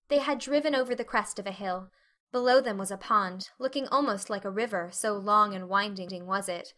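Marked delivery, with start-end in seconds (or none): none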